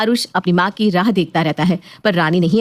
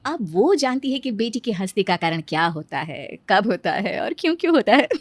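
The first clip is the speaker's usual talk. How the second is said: usual speaking voice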